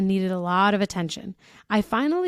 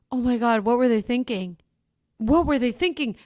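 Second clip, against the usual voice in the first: ordinary delivery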